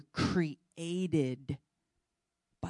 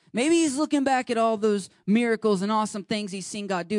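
higher and louder, building preaching; rising fervour; 6 semitones higher, 5 dB louder than usual. Real, not acted. Preacher building energy for the congregation, higher and louder. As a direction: lift it up and drive it home. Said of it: "preacher builds intensity".

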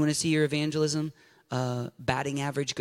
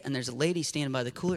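ordinary talk

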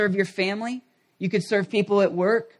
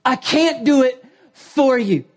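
higher, louder, slow pleading coach; exasperated coach; 5 semitones higher, 4 dB louder, slower than usual. Retold or imitated. Coach pleading with players he can't help, exasperated and slow. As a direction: plead slowly, worn out by the team. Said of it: "he's so exasperated".

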